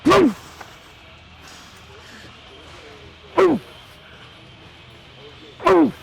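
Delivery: sharp loud grunt on each rep; effort shout. Real, not acted. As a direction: bark it out as the weight goes up. Grunting out reps mid-set.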